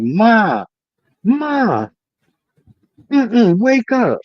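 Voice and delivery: high, drawn-out mock child's call; imitated screaming toddler. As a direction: pester a sleeping mother in a high little voice. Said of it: "I just heard her screaming".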